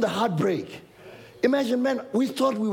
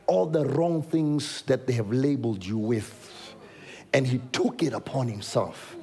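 gentle good news; 8 semitones lower than usual. Acted. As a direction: break the good news tenderly.

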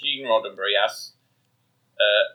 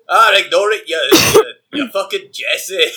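high, loud Scottish jeering; sweary Glaswegian engineer, hammed up; Scotty; 7 semitones higher, 7 dB louder, slower than usual. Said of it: "he'd instead say".

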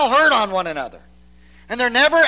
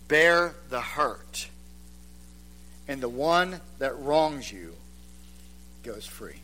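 soft slow; 8 semitones lower, 10 dB quieter than usual. Acted. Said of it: "Let me repeat it".

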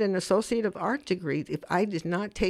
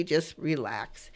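soft, slow and lower; soothing; 5 semitones lower, 5 dB quieter, much slower than usual. Real, not acted. Host settles listeners at home before the pledge, soothing. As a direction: ease them down, slowly and softly.

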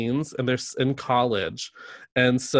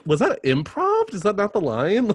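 mock disbelief; 7 semitones higher than usual. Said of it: "I'm like".

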